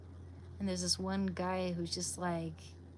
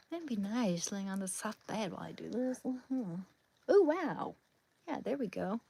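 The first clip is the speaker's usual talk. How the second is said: ordinary delivery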